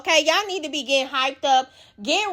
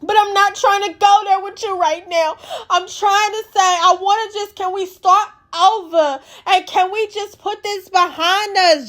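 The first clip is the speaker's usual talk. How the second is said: pleading Nick; 7 semitones higher than usual. Retold or imitated. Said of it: "She was like".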